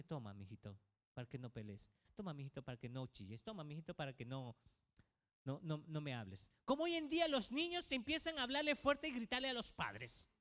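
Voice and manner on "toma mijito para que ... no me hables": low, soft, pleading daddy giving in; giving-in father. Acted. Father giving in to a demanding child, low and soft. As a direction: coax the child softly, low and hushed.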